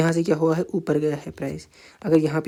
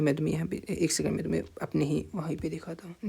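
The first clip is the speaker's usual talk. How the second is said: whispered aside; 9 dB quieter than usual. Real, not acted. Teacher mutters aside while opening chart.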